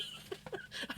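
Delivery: airless wheezing laugh; breathless laugh. Real, not acted.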